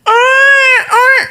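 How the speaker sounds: high imitated child's wail; tangis anak. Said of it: "Anak nangis". (tiruan tangis anak melengking tinggi)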